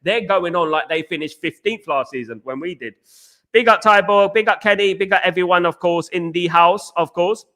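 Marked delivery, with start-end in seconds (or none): 3.5-7.4 loud shout-out. Real, not acted. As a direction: boom out the greetings to chat. Shouting out chat viewers.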